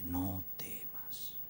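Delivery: very slow, low whisper; hushed reassurance. Acted. God reassuring a confused believer, hushed and slow. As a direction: breathe it out slowly and low, to calm. God's reassuring word, voiced softly.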